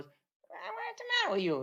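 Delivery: very high, squeaky mock scold; squeaky Arturo voice. Arturo scolding Mickey for hesitating, very high squeaky voice. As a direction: squeal it out like a teasing friend. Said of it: "He got that squeaky Itali- uh, Italian, uh, French voice".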